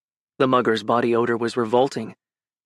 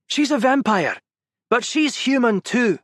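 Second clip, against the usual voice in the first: heated plea; 10 semitones higher than usual. Acted. Gavin's line later remembered by Ben.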